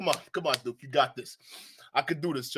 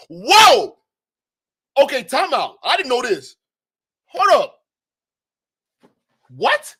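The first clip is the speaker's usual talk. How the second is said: shocked; 6 semitones higher, 8 dB louder than usual. Real, not acted. Shocked reaction to price.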